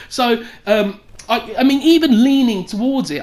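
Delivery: ordinary talk, nothing marked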